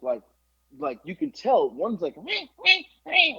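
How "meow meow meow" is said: very high-pitched imitated yips; squeaky mock barking; small dog yapping. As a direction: yap like a tiny rat of a dog. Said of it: "like a little fucking rat probably".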